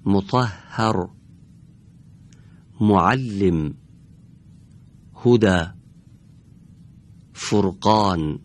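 slow, careful word list with a short opening u; measured Arabic recitation with a brief damma. Shown as a demonstration. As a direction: read each word deliberately, first vowel kept short.

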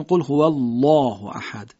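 thick, dark l in الله; heavy lam. Shown as a demonstration. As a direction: far back, full-mouthed الله. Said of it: "تغلیظ درشتی لام".

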